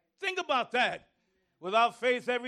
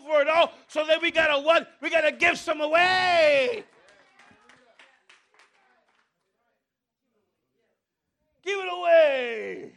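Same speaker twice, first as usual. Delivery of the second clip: preaching shout; 3 semitones higher, 6 dB louder than usual. Acted. Preaching at full force.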